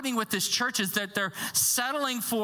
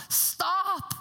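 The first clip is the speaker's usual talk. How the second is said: pleading stop; 6 semitones higher than usual. Retold or imitated. Paul voiced pleading with church.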